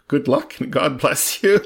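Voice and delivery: hammed-up piety; pious. A narrator's reading. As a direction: bestow it like a pious well-wisher. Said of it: "he added in a pious tone".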